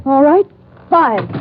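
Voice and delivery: final threat. Acted. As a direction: snap out the last number. Gunpoint countdown threat.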